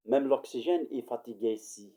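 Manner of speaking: usual speaking voice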